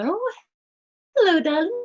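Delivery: high, sing-song cooing to a newborn; baby talk. Retold or imitated. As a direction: coo melodically like greeting a new baby. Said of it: "that way that we kind of talk where we go".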